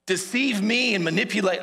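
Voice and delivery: loud, acted-out indignation; furious. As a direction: play the outraged man confronting his deceiver. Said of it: "He's so angry. He's like".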